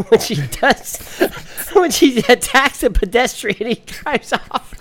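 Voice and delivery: reading through giggles; can't stop laughing; laughing while reading. Real, not acted.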